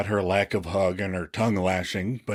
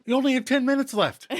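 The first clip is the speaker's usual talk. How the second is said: high-pitched urgent mock scolding; exasperated lambasting; 13 semitones higher, faster than usual. [name] warns Joey of the deadline, exasperated. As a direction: hammer the deadline home in a high voice. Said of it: "[name] lambasts".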